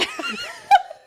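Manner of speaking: high, loud burst of laughter; real laugh. Real, not acted. Storyteller amused by her sister laughing, loud real laugh. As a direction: crack up mid-story.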